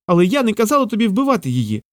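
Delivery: high-pitched, mildly shouted protest; high little squirrel voice, protesting; squirrel's protesting cry. A narrator's reading. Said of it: "закричала білка".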